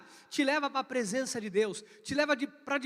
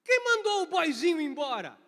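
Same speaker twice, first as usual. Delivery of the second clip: loud, higher protective demand; protective boss; 5 semitones higher, 6 dB louder than usual. Retold or imitated. The boss confronting HR over the fired office boy, loud. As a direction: stand up for the boy. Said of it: "Ele olha pra mim e fala".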